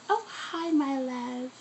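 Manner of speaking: soft high cooing greeting. Real, not acted. Greeting a baby.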